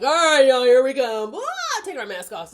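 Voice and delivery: loud, high, hammed-up call; mock announcement. Masked boys announcing their own unmasking, loud and high. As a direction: shout it like a cheesy reveal.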